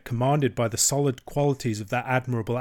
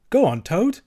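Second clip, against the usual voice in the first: high, loud urging; eager interest; 8 semitones higher, 6 dB louder than usual. A narrator's reading. Mole urges Toad to continue, eager interest. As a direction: egg the storyteller on eagerly. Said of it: "immensely interested".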